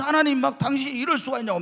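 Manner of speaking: high, loud fist-shaking protest; railing at God. Retold or imitated. Churchgoer shaking his fist, demanding answers from God. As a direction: rage at God like a wronged man. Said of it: "막 하나님께 따지면서 막 난리를 친다".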